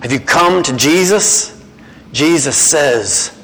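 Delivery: normal talking voice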